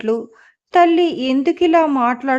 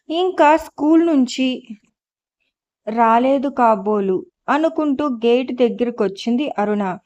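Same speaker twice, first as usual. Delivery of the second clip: ordinary delivery, nothing marked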